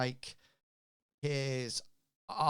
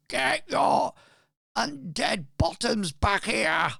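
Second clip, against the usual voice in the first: much higher, much louder bellow; angry shout; 11 semitones higher, 10 dB louder than usual. Acted. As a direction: roar at the crew across the deck. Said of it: "He's shouting at them".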